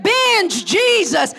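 slow, high, loud Binge Jesus; shouted refrain. Acted. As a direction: hammer it home, slow and loud. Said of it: "preacher's slow shouted refrain".

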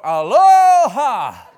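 loud, slow, high squawked greeting; parrot voice. Retold or imitated. Preacher imitates his parrot greeting him at dawn. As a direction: belt out a stretched parrot hello. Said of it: "I come in in the dark".

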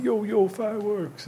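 high, slow sales call; imitated street hawker. Imitating guys cruising Chinatown selling fireworks, hawker's call. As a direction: tout your wares to passers-by, drawn out and high.

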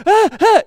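sharp, high, loud mock gasps; startled gasps. Passenger on the boat watching the storm, startled gasps. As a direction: gasp as if stunned by what you see.

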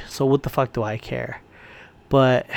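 ordinary talk, nothing marked

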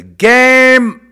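long, high, loud cry; emphatic shout. Real, not acted. Announcing the break after centuries, emphatic shout. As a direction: blast the word out and hold it. Emphatic climax on one word.

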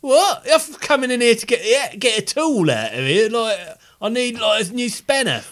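high, loud comic caller after a tool; loud, high-pitched skit character; baffled shed visitor. Acted.

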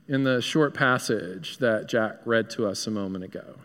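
ordinary talk, nothing marked

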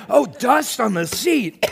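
high, lightly voiced embarrassed guy covering tears; mock excuse. Pastor playing a guy at the movies excusing his tears, mock casual.

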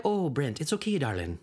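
lightly voiced motherly nanny; a hint of a soothing old nanny; Mrs. Doubtfire voice. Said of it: "The Firefly?".

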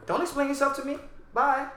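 high, breezy brush-off; dismissive brush-off. Retold or imitated. To an apologizing customer, a high breezy brush-off. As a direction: wave them off lightly.

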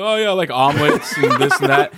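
loud, slowed quote with laughter; amused retelling. Retold or imitated. Wrestler answering the breakfast question, laughingly retold. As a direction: play the answer through a laugh. Acting out interview, laughing along.

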